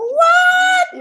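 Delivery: a huge high shouted What?; retold astonished cry; shocked what. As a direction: yelp it in total disbelief. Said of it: "And I'm like".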